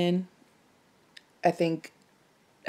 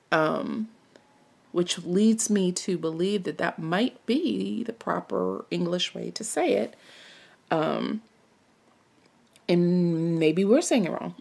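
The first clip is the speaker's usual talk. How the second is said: ordinary talk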